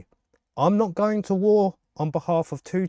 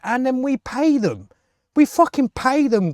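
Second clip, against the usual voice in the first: rising higher and louder in anger; building fury; 7 semitones higher, 3 dB louder than usual. Real, not acted. Vlogger furious that taxpayers pay them, rising to a shout. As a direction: climb into an indignant yell.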